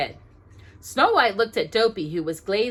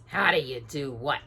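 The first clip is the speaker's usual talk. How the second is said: lower, gruff retort; grumpy dwarf; 6 semitones lower than usual. A narrator's reading. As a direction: snap back as a frowning dwarf. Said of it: "answered Grumpy with a frown".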